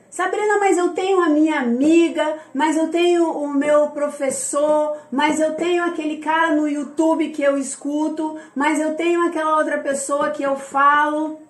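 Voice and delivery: lightly mocking list of objections; imitated protest. Voicing a follower's string of objections.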